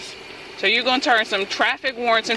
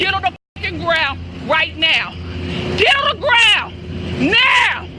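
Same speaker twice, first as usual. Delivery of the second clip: shouted surrender demands; 6 semitones higher than usual. Real, not acted. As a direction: shout her to the ground. Officer ordering suspect down.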